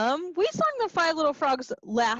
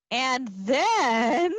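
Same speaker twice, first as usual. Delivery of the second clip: ordinary delivery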